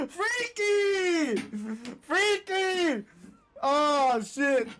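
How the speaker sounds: excited. Real, not acted. Laughing at a weird lore.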